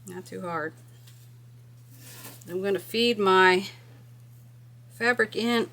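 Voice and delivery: ordinary delivery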